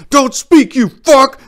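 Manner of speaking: very high, very loud screamed threat; furious yell. Acted. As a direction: scream at the enemy soldier. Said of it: "I yelled".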